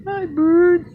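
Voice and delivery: squeaky falsetto greeting to a bird; high bird call. Real, not acted. Man greeting visiting birds in a squeaky falsetto. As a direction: call up high and thin like a birdie.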